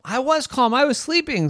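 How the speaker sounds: high, protesting bee; insist, offended. Acted. Bee defending itself to the cat, high protest. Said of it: "said the bee".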